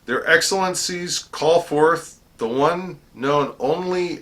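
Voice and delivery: high, slow, loud proclamation; formal herald's summons. Retold or imitated. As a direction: call it out ceremonially across the hall. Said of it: "he straightens up".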